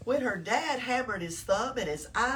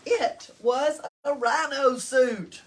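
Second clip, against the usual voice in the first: big reveal; 4 semitones higher, 9 dB louder than usual. A narrator's reading.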